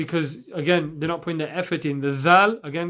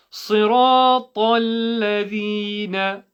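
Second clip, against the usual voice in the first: slow chanted demonstration with held vowels; higher, measured chant; Quranic recitation; 4 semitones higher, 4 dB louder, much slower than usual. Shown as a demonstration. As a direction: recite syllable by syllable.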